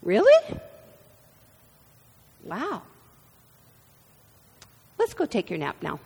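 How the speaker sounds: lightly mock-surprised mom, then brisk; a hint of a patient mother, quick at the end; mom's response. Mother answers child refusing a nap, lightly mock-surprised. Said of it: "I got responses like".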